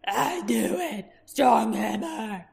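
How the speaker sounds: very slow brutish creature voice; grudging Urgle assent; Urgle agreeing. Acted. Urgle agreeing to Roran, very slow.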